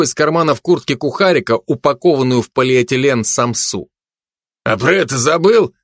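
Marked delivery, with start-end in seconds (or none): 4.6-5.7 high, mocking needling; ironic advice. A narrator's reading. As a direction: rub it in with irony. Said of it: "посоветовал Замшелов коллеге с иронией".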